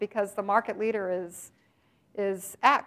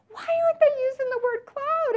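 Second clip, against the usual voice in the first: exasperated disbelief. Pretend. Mocking company's avoidance of cloud.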